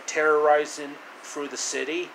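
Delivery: plain reading voice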